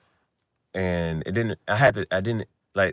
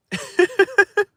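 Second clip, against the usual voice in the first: loud high cackle of laughter; 7 dB louder than usual. Real, not acted. Laughing at drunk friend.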